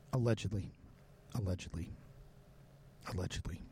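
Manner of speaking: a low, quiet caveat, repeated; disclaiming aside. Real, not acted. Host covering himself after an accusation, low disclaiming aside.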